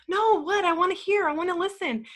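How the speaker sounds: high, startled insistence that she's listening; eager protest. Retold or imitated. Retelling how she assured her husband she wanted to listen, high and eager. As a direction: reassure the husband she wants to hear, keen.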